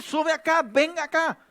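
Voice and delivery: high, loud voice of command from above; the Lord's commanding call. Acted. Preacher voicing the Lord calling his church up, booming command. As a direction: call the faithful up like God himself. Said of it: "Eso es lo que nos va a decir".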